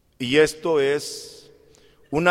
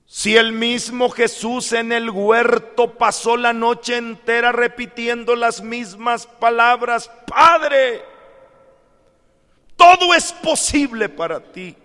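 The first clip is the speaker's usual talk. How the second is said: high, loud sustained proclamation; full-force pulpit delivery; impassioned preaching; 7 semitones higher, 6 dB louder than usual. Acted. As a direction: build to a ringing cry to the Father. Preacher cries out Jesus' prayer.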